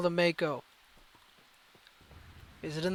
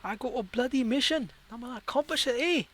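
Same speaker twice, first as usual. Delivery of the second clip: mock Scottish accent, slightly higher; a clumsy attempt at a Scottish brogue; bad Scottish accent; 4 semitones higher, 3 dB quieter than usual. Player talking back to the game's crew, in a bad Scottish accent. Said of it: "worst Scottish accent I've ever even tried".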